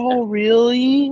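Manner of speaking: high, very slow mock amazement; fake nice surprise. Mocking overly nice people, high and drawn-out. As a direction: drag it out high, wide-eyed fake interest. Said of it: "you could tell they're faking it".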